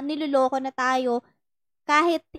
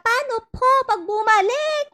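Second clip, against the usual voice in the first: high, pleading little voice; girl pleading, "what if he comes back"; quoting the young follower; 9 semitones higher, 4 dB louder than usual. Retold or imitated. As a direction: mimic a girl begging for reassurance. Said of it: "Tas nag-reply siya".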